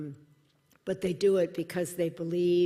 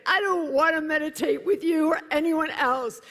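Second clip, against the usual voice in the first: very high and louder; high, loud exasperated no; indignant refusal; 11 semitones higher, 7 dB louder than usual. Retold or imitated. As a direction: push him away with indignation. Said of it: "I was like".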